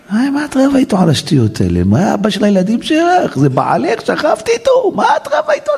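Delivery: high mocking voice scolding a wife. Rabbi mocking a wife who fights her husband, high scolding.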